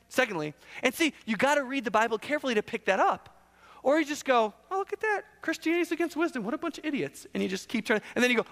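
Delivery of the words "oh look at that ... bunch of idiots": airy, high-pitched scoffing; scoffing reader. Acted. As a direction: parody a dismissive skeptic up high. Voices a scoffing careless reader.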